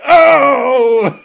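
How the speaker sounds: high, loud cry of pain as he tries to stand; pained cartoon yelp. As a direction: yelp like a cartoon villain whose back gives out. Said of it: "he's like".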